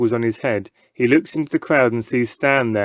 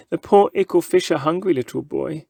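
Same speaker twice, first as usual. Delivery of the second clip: higher, mocking baby talk; 5 semitones higher than usual. A narrator's reading. Showman taunting the little boy with baby talk. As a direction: tease him with false pity.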